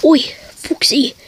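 sneaky whisper. Acted. Secret whisper to accomplice.

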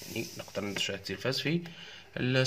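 ordinary talk, nothing marked

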